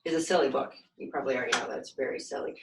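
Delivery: ordinary delivery, nothing marked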